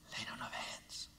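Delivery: breathy stage whisper of the key words; a secret whispered to the congregation. Shown as a demonstration. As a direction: lean in and whisper it. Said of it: "whisper this".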